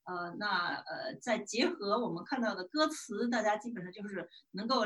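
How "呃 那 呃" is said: ordinary talk, nothing marked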